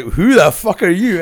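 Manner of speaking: retold brusque challenge. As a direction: play the baffled boss confronting a stranger. Acts Rob challenging the stranger.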